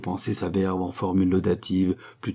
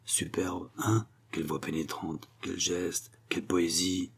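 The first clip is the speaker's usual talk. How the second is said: hushed, breathless whisper of admiration; whispered praise; 2 semitones lower, 10 dB quieter than usual. Acted. Old actor whispering praise during the audition, hushed. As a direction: lean in and whisper it under your breath. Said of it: "murmurer en sourdine".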